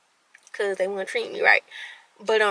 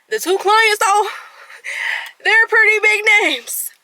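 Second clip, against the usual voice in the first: hyped-up tease; 10 semitones higher, 4 dB louder than usual. Real, not acted. Teasing big names after aside.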